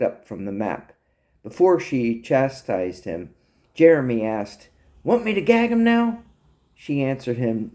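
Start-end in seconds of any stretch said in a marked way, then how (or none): 5.0-6.2 high-pitched eager offer; lightly comic keen sidekick; eager underling. Acted. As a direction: ask hopefully, pitched way up. Jeremy eagerly offers gag.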